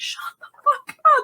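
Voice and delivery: very high-pitched mock shout; strained, high shouted command; retold yelling. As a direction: imitate a sergeant yelling someone down. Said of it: "whole conversation yelling back".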